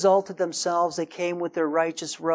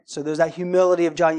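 normal talking voice

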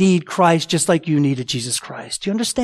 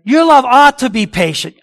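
mock preachy quote; 6 semitones higher, 7 dB louder than usual. Mocking the "ought" reading.

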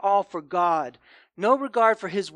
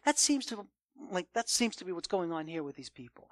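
ordinary delivery

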